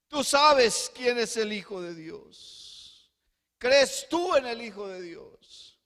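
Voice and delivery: loud, high-pitched impassioned sermon; full-force pulpit fervor; fervent preaching. Acted. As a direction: preach it out to the whole church. Jesus quoted at full preaching force.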